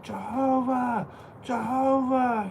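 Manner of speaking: drawn-out high call across the lot; yelling out. Retold or imitated. Shouting after the bikers across the parking lot, drawn-out high call. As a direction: call the name long and high. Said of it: "I start yelling out".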